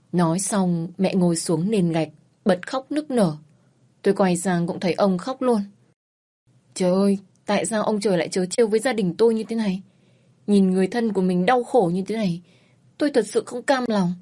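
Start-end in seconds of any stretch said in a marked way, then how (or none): none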